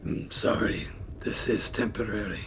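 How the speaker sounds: low, whispery apology in character; hushed apology. Acted. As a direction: apologise softly, almost under your breath. Player answers in character, hushed.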